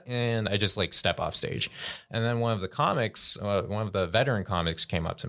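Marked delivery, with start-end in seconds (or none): none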